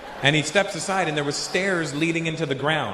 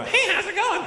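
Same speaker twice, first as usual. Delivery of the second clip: very high-pitched mock greeting; mock over-friendly greeting. Guy greeting a bouncer the uncool way, squeaky mock-friendly. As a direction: squeak out an overeager hello. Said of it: "we don't walk up and go".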